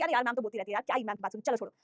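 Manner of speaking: low, quiet mock student brushing it off; dismissive student. Student shrugging off ma'am's repeated scolding, low and dismissive. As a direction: mutter it like a student who has stopped caring.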